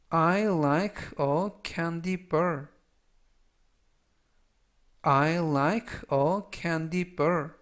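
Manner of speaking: very slow, word by word English; slow model reading. Shown as a demonstration. Teacher repeating a sentence slowly for students to copy. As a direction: spell it out in sound, slowly.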